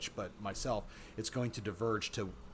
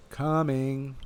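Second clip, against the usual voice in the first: drawn-up, higher, louder reply; calling back; 5 semitones higher, 7 dB louder than usual. A narrator's reading. Pat answering the guy who called her, calling back. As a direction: sing it out to someone across the way.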